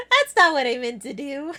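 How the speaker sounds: words squeezed between laughs; laughing. Real, not acted. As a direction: talk through giggles. Laughs at own mistake.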